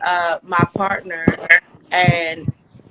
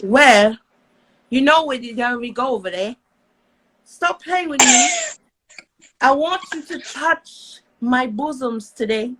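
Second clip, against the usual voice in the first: a rough, hammed-up Scottish accent, higher at times; 4 semitones higher than usual. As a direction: tease in a mock Scottish accent. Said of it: "Lil wants you to do Scottish".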